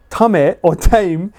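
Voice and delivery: "Tame" as TAH-meh, then "tame" as taym; drawn-out contrast, "Tame" tah-meh versus "tame" taym; two pronunciations contrasted. Shown as a demonstration. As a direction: slowly set "Tame" Japanese-style against "tame" in English. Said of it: "if words are pronounced Japanese way".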